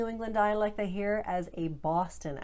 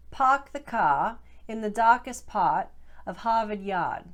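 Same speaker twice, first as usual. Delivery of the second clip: Boston "Pahk the cah" sentence, R dropped, louder; Boston accent; 3 semitones higher, 6 dB louder than usual. Shown as a demonstration. Coach models the classic Boston practice sentence, R-less. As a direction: say it like a Bostonian, long ah. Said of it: "Let's try a few more".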